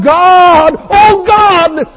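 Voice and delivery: high, loud anguished shout to heaven; crying out. Acted. Preacher as Job calling for God in his losses, crying out. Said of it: "And he cries out".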